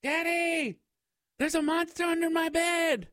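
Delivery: put-on very high-pitched little kid calling out; high child voice, calling down the hall; frightened child yelling. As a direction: cry for mom and dad in a tiny child's voice. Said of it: "yelling down the hall".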